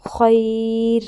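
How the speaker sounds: خیر stopped on a thin, light ر, a bit loud; stopping form. Shown as a demonstration. Teacher repeats the pausal form, thin ر. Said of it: "را کو باریک پڑھیں گے".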